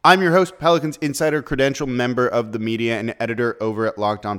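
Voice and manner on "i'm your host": ordinary talk, nothing marked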